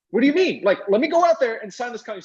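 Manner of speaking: high, loud startled protest; teen son startled by dad's warning, high and loud; shocked disbelief. Retold or imitated. Shocked teen reply to dad.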